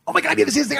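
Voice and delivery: imitating an overeager programmer bursting in; excited coworker. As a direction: coworker rushing in with a new idea, excited. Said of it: "so excited".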